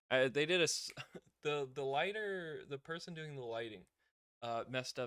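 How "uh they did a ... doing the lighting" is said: ordinary delivery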